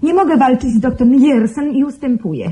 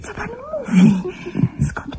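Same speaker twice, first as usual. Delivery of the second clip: sharply higher, breathless question; shocked gasp; 13 semitones higher, 9 dB quieter than usual. Acted. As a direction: caught off guard by terrible news. Shocked by news of death. (wstrząśnięta, z niedowierzaniem)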